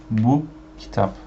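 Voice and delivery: Bu kitap with Bu stressed and falling. Shown as a demonstration. Teacher showing learners the stress, Bu stressed. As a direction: lean on Bu, then let kitap fall. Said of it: "you say like this".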